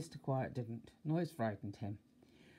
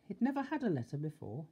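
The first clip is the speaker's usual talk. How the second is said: ordinary delivery, nothing marked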